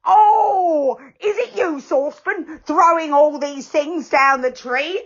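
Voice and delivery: loud high startled yell; giant's yell at the falling things; tremendous yell. A narrator's reading. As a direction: bellow up into the tree. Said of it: "He gave a tremendous yell".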